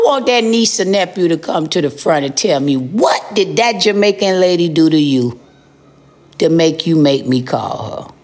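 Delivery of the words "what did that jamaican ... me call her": ordinary delivery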